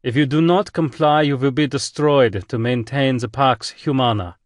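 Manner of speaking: put-on commanding admiral in a thick German accent. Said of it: "The voice had a thick German accent".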